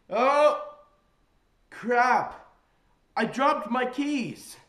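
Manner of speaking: loud, high staged dismay; mock-panicked shout. Prankster on a ride faking dropped keys, panicked shout. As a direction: yell it out as a fake mishap.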